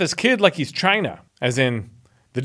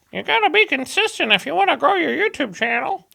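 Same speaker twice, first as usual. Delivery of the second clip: mock advice voice; 10 semitones higher than usual.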